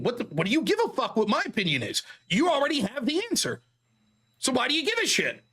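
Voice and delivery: ordinary talk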